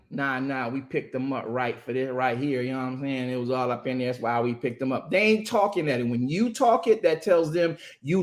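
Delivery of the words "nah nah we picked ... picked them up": low officer voice. Retold or imitated. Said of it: "they not writing".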